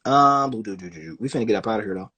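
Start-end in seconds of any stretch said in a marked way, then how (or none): none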